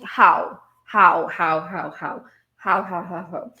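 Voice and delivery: loud, incredulous how how how; baffled repetition; exasperated how. Real, not acted. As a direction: throw up your hands at it. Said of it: "exasperated repeated how".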